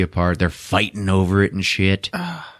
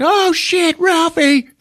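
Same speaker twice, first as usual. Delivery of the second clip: very high mock-panicked cry; alarmed mom; 4 dB louder, slower than usual. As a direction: shriek it like a worried mother. Voices alarmed mom hearing the crying.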